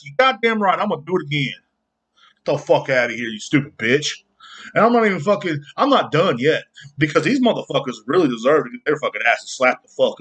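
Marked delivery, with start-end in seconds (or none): none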